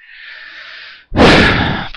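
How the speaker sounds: loud exasperated exhale; an audible sigh of disgust; heavy sigh. Real, not acted. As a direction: let out a big, fed-up breath. Exasperated rant about the news.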